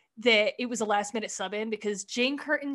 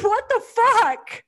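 loud, high, drawn-out outburst; slow, incredulous exclamation, pitched way up; shocked disbelief; 12 semitones higher, 8 dB louder, slower than usual. Retold or imitated. Host recalling reaction to the sketch's cemetery line, shocked disbelief.

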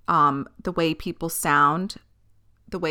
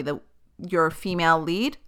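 normal talking voice

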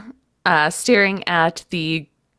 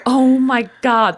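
slow, higher-pitched astonishment; amazed; 4 semitones higher, 4 dB louder, slower than usual. Real, not acted. Co-host takes in the family connection, amazed. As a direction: draw it out in wonder.

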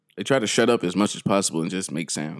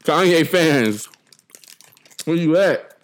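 calling out; 6 semitones higher, slower than usual. Acted. Calling out to [name] fans.

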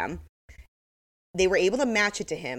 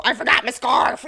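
imitating Jen; 7 semitones higher, 6 dB louder than usual. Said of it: "people like Jen just don't go".

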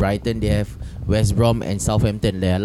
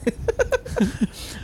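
high-pitched giggle; 6 dB quieter than usual. Real, not acted. Laughing at second lady joke.

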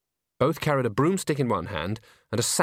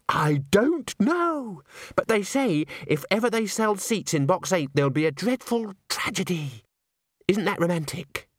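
gushing Christine; 8 semitones higher than usual. Acted. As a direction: savour the dreadful tale with girlish relish.

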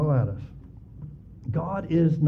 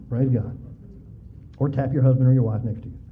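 usual speaking voice

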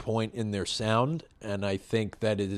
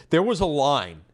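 a touch higher, louder scolding; impression of the woman; 6 semitones higher, 6 dB louder than usual. Retelling the woman at the car wash, mildly mocking. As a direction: mimic her pointed reproach. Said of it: "a very good impression of this woman".

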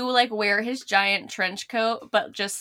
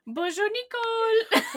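slow, much higher exaggerated French accent; sing-song French bonjour; mock French greeting; 13 semitones higher than usual. Imitating Juan greeting Nicole, exaggerated French accent. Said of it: "Juan, when he was like".

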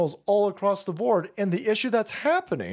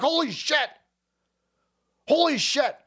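high, put-on voice of panicking Democrats; mock panic; 7 semitones higher than usual. Panicking Democrats react to bad polls, high mock alarm. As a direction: play the panicked Democrats blurting it out. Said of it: "That's why they're like".

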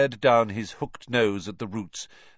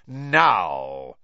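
loud, drawn-out menacing order; one word of command, sliding downward; Snape's dangerous voice; 6 dB louder than usual. A narrator's reading. Teacher ordering Ron to obey, dangerously. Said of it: "in his most dangerous voice".

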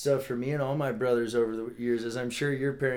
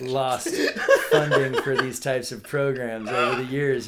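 laughing through the words; 3 semitones higher, 8 dB louder than usual. Real, not acted. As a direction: chuckle while saying it. Laughs at own basket-weaving joke.

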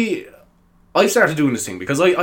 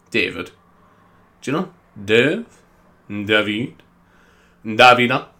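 ordinary delivery

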